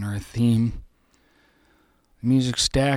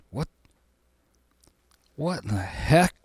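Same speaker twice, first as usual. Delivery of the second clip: ordinary delivery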